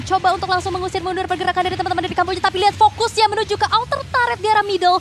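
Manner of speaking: loud, high play-by-play call; excited commentary. Real, not acted. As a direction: hype up the team fight. Play-by-play of a team fight.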